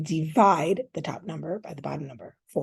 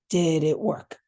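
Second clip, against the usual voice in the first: usual speaking voice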